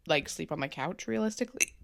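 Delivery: ordinary talk, nothing marked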